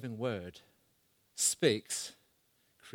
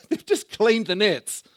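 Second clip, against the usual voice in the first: laughing; 7 semitones higher, 8 dB louder than usual. Real, not acted. Laughing at the tired fishermen.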